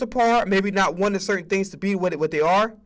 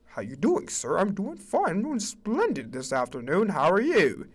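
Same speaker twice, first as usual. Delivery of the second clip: prim office small talk; mock formal colleague; 2 semitones lower, 5 dB quieter than usual. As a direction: play the stiff coworker.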